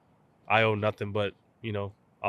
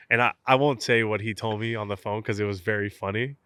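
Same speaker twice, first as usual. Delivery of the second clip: ordinary talk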